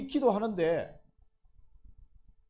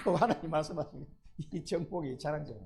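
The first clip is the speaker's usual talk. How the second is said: ordinary talk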